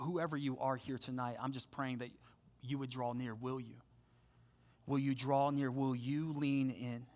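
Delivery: quiet heartfelt invitation to lean in; tender plea. Real, not acted. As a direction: draw them in gently. Pastor's quiet altar call.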